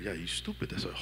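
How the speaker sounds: soft, mocking taunt of the enemy; sly taunting voice. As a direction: murmur the insult like a whispering tempter. The enemy's voice answering.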